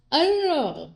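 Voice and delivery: high, pitiful I don't know; mock blubbering. Wife imitating her tearful self, high whiny voice. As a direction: act out your teary helpless self. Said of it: "I'll just".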